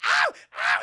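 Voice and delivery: loud repeated yelp; mock pain yelp. Lana with her hair on fire, loud mock yelps. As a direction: imitate sharp cries of pain.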